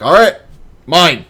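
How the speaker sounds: claiming bacon. Retold or imitated. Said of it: "I'm like".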